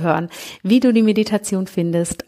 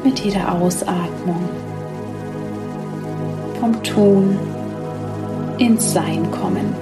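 sleep meditation. Acted. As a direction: guide them gently into sleep.

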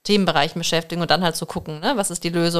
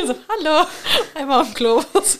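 laughing mock greeting; 7 semitones higher, 3 dB louder than usual. Voicing returning plastic.

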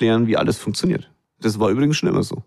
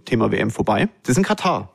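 ordinary talk, nothing marked

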